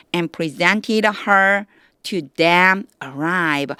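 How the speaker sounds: slow, with breaks around "to them" and before "alive"; phrase-by-phrase pauses. Shown as a demonstration. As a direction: read "And presented her to them alive" slowly in separate chunks. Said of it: "요거 잘 끊어서 보셔야 돼요". ("to them"과 "alive"를 끊어서 천천히 읽기)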